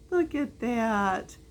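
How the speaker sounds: ordinary delivery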